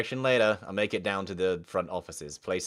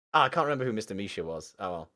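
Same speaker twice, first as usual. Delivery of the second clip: ordinary talk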